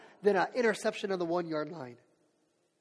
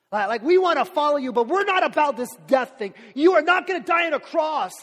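louder, higher pleading objection; loud protest; 7 semitones higher, 11 dB louder than usual. Acted. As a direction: push back hard on the plan. Peter protesting Jesus' death.

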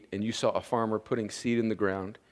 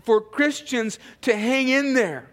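higher, driving sermon delivery; impassioned preaching; 13 semitones higher, 7 dB louder than usual. Real, not acted. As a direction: preach it out to the whole room. Preaches at full force.

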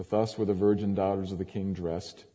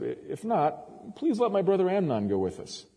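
much higher, coaxing plea; pressing request; 10 semitones higher than usual. A narrator's reading. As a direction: coax the king with a raised pitch. Reads Absalom pressing the king.

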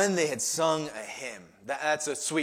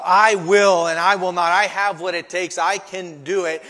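loud, punchy declarations; self-reliant; 3 semitones higher, 11 dB louder than usual. Shown as a demonstration. Preacher voicing what must change in us, loudly self-confident. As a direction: assert it hard, phrase by phrase. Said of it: "Our self-reliance, our self-confidence".

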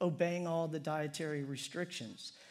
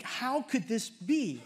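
higher and slow, a lightly voiced stunned Paul; incredulous Paul; 6 semitones higher, 4 dB louder, slower than usual. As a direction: pitch it up in mock disbelief. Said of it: "he's like".

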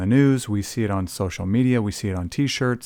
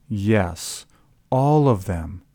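ordinary talk

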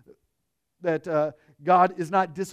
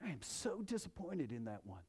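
mock disappointed sigh; 8 semitones lower, 18 dB quieter, faster than usual. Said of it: "shaking his head as like".

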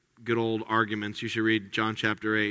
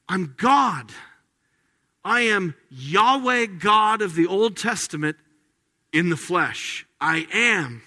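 louder, higher proclamation; bold divine claim; 5 semitones higher, 3 dB louder than usual. Retold or imitated. Preacher declares Jesus' claim with bold force. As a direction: proclaim it boldly. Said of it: "It's because he said".

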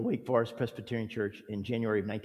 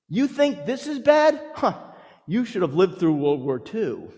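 high, louder put-on voice of a know-it-all; mock platitude; 8 semitones higher, 7 dB louder than usual. Mocking a friend who belittles grief. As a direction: send up a dismissive comforter. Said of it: "crass statements like this".

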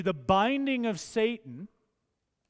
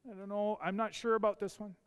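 unsure listener; 3 semitones higher, 4 dB quieter, much faster than usual. Acted. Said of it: "'cause you're like".

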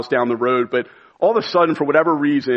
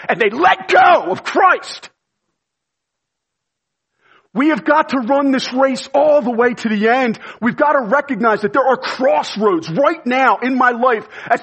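raised, high-pitched preaching cry; impassioned preaching; 10 semitones higher, 3 dB louder than usual. Real, not acted. Preacher warning believers who drift from Christ, impassioned cry. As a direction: cry it out urgently to the whole congregation.

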